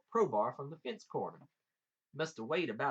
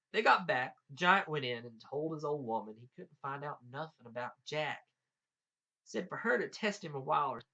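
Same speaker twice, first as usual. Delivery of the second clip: ordinary delivery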